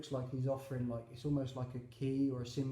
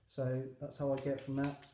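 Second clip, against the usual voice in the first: ordinary delivery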